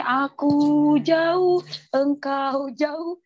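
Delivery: very slow, drawn-out mock chant; mock slow sing-song. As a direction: stretch each word lazily. Mocks drifting apart. (diucapkan sangat lambat seperti mengejek)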